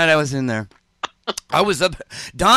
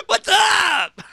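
laughing shout. Real, not acted.

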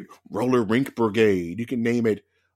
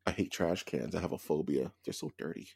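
disgusted whisper; 5 semitones lower, 8 dB quieter than usual. Real, not acted. Trash can phobia.

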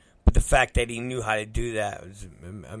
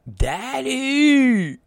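put-on high loud child's cry; child calling daddy; 6 dB louder than usual. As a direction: call out like a little kid. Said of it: "kid calls out".

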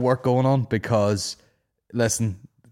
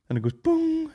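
mock boom sound. Imitating app breathing sound.

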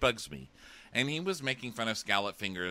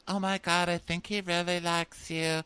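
higher, put-on gushy voice; gushing mom; 8 semitones higher than usual. Mom reassures Annalise he likes her, mock gushing. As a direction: imitate an encouraging mom in a higher voice. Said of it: "the mom's like".

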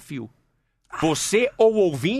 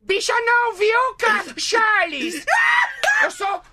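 very high, indignant camp shriek; hammed-up falsetto outrage, slow on the last line; Vera Verão impression; slower than usual. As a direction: take mock offence like a flamboyant diva. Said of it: "Ele falava assim".

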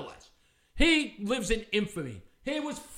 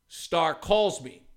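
usual speaking voice

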